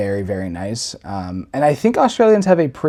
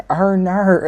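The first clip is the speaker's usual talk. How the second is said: higher, mock Australian "oh no"; Australian accent; 8 semitones higher, 3 dB louder than usual. Giggling at what's heard in public, mock Australian accent. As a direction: imitate an overheard Australian accent. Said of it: "I hear it and it makes me giggle".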